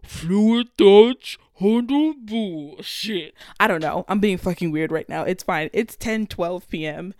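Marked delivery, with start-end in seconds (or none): 0.0-3.3 slow, louder, drawn-out title like a sound-effect intro; mock a radio jingle, stretching each word; show intro voice. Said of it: "and it's gonna be like".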